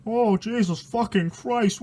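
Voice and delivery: plain reading voice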